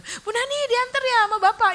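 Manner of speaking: high-pitched neighbourly questioning; put-on high voice, nosy and chatty. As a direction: imitate a curious neighbour calling over.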